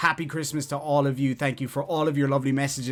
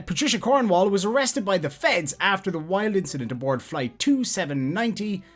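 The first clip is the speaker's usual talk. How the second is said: news read; 5 semitones higher, 4 dB louder than usual. Acted. Reads a news article aloud.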